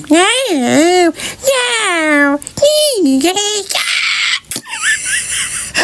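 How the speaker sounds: high, wild burst of laughter; squealing belly laugh; shrieking laugh. Real, not acted. Laughs about cat whine.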